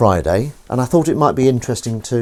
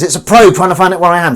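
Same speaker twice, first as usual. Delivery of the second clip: high, loud action-scene urgency; urgent alarm; 8 semitones higher, 7 dB louder than usual. Acted. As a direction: raise the alarm dramatically. Cat raises alarm in action scene.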